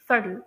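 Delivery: सटल with the t flapped like a light d; American pronunciation. Shown as a demonstration. Teacher applying the flap rule, American pronunciation of सटल. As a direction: say "सटल" American style, like "suddle". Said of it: "इसी तरह से आएगा".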